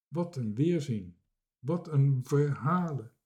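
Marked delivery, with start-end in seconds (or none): none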